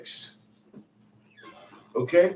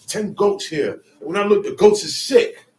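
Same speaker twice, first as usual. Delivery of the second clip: normal talking voice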